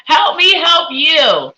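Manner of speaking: big, slow, drawn-out comic wail; hammed-up pleading cry; mock plea. Psychic begs a viewer for a clearer question, mock wailing.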